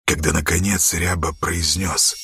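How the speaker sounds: plain reading voice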